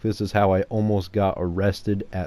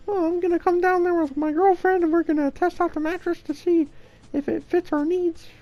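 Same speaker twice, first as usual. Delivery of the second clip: very high, squeaky prank caller; weird squeaker voice. Retold or imitated. Prank caller phoning a mattress store, weird squeaker voice. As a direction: squeak it out far above your normal pitch. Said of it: "a really fucking weird squeaker voice".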